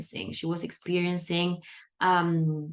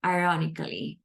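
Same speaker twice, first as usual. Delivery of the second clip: a dry, deadpan "Ironically."; dry deadpan aside; 3 semitones lower, faster than usual. Retold or imitated. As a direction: drop "Ironically." in low and flat after a pause. There was a long pause before I added.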